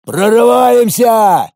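loud, drawn-out battle cry; sudden shout. Acted. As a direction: yell it slowly and high. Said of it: "Внезапно выкрикнул Иван".

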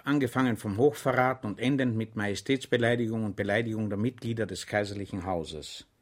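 ordinary delivery

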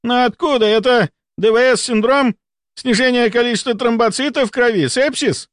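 high, squealing frantic questions; panicked screech. A narrator's reading. Volkonsky guesses at causes of bleeding, panicked screech. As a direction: fire questions in a voice risen to a squeal. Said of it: "Голос Волконского поднялся до визга".

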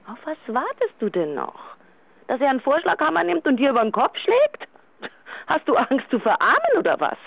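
rising exasperated outburst; worked-up urging. Acted. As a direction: push her hard to act now. Friend urging divorce, worked up.

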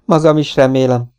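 ordinary delivery, nothing marked

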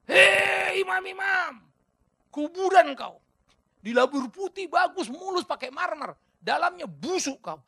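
high, loud, slow furious denunciation; angry Jesus. Acted. Jesus denouncing the priests as whitewashed tombs, shouting. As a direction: rebuke the priests with fury. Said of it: "Makanya Yesus juga marah".

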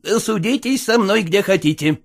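loud, heated scolding. Acted. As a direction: lose your temper at the servants. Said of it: "горячился он".

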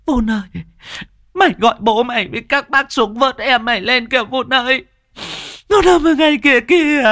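very high, urgent wailing cries; frantic mother. A narrator's reading. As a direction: call out desperately for help for your child. Frantic mother points at the river.

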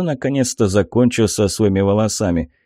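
usual speaking voice

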